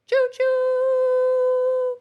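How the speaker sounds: very high, loud, sustained hoot; train-whistle call. Pretend.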